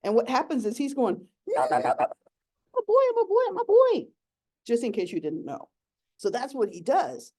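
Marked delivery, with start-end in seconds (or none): 1.5-4.1 high, loud little yips; squeaking toad. Acted. Male toad protests being picked up, high squeaky yips. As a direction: squeak like a tiny chihuahua. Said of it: "sounds like a tiny chihuahua".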